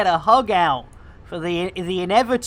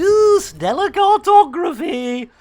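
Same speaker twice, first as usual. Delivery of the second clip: high, plummy imitation of the ship voice; a hint of a posh computer voice; mock posh; 9 semitones higher than usual. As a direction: echo it in a lightly posh voice. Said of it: "I do love it when he's all posh".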